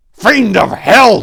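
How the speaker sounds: loud, high, slow furious outcry; furious cry. A narrator's reading. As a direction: hurl it at the vampire in fury. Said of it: "Tor cried, his voice full of fury".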